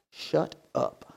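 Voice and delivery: hushed, barely voiced scolding; a parent's scolding dropped almost to a whisper; mad parent, hushed. Preacher mimics parent mad at complaining kids, hushed scolding.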